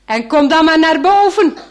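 loud call down. Acted. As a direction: call the boy up. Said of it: "zegt die man".

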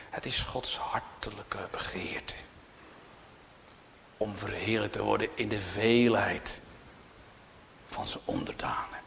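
soft whispered reverence; hushed whisper. Real, not acted. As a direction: breathe it quietly. Hushed sincere aside.

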